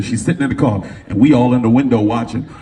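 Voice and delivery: ordinary talk, nothing marked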